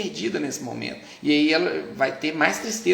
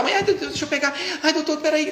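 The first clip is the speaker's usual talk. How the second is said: high, frantic, half-laughing mother; flustered mother; 13 semitones higher, 3 dB louder than usual. Retold or imitated. Mother panicking when the baby cries at the doctor, high and frantic. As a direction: mimic the panicked mum reaching for the baby.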